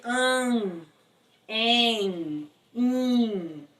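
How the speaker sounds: "an", "en", "in" held through the nose; nasalized vowels. Shown as a demonstration. Teacher showing what M does, nasalized vowels. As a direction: draw out each nasal vowel in "an, en, in". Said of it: "nasalização da vogal anterior".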